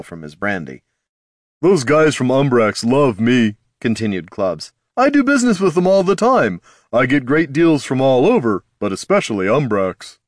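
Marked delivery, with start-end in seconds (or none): 1.6-3.6 higher, louder, cocky trader; boastful merchant voice. Acted. Clubs boasting again. 5.0-10.2 higher, louder, cocky trader; boastful merchant voice. Acted. Clubs boasting again.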